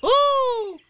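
very high, loud squeal; excited Roo. A narrator's reading. Roo hearing Eeyore was bounced, excited squeal. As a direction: squeal it like a tiny thrilled child. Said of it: "said Roo excitedly".

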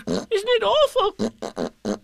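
high, slow, drawn-out squeal; Piglet. A narrator's reading. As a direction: squeal it in dread, stretching it out. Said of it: "said Piglet".

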